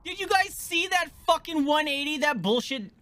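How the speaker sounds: shrieked high and loud, real outrage; high, loud enraged yell at the chat; furious disbelief. Real, not acted. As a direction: scream it at the screen, can't believe it. Boss spins and kills him.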